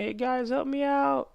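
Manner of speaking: high, drawn-out mock cry for help; mock scream. Titan guy begs enemies for help, mock scream. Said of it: "screaming like".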